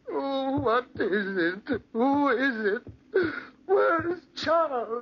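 high, loud, near tears; an old man begging into the dark; terrified old man. Acted. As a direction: plead in rising panic. Old man crying in fear.

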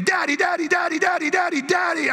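put-on high, insistent, nonstop child's call; pestering little kid. Little son interrupting dad while he talks, pestering. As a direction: pester like a kid wanting attention. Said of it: "they'll just stand there".

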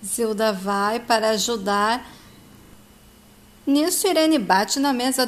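usual speaking voice

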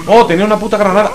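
fast, loud and high; startled exclamation. Real, not acted. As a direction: blurt it out startled. Surprise at grenade.

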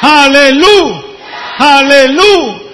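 loud, drawn-out shouted praise, high and ringing; preaching at full force. Real, not acted. Shaliach exhorting the congregation, full-force preaching shout. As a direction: shout the praise to the back of the hall. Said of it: "habla fuerte".

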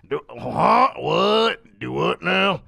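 lightly imitated Southern accent; a baffled Southerner, lightly put on. Southern customer interrupting a sales call, mock Southern accent. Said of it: "talking to somebody in the South".

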